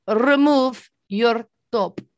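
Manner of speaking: slow, stern mock security guard. Retelling the security guard's slow demand. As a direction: drag out the order like a stiff guard. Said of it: "He went".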